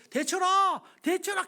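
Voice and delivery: high, loud calling out a name. Retold or imitated. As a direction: call him from outside. Friend calling outside. (밖에서 친구를 높고 크게 부름)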